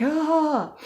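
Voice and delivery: high, loud squeaky "ah" of panic; mock panic cry. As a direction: let out a little panicked yelp. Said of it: "I'm just like".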